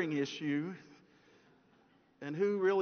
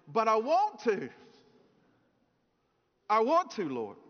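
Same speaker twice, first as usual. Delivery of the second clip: louder, higher mock pleading; childlike insistence; 4 semitones higher, 5 dB louder than usual. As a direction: act out a child insisting on getting her way. Eve voiced like the insisting child.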